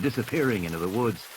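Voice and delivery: ordinary delivery, nothing marked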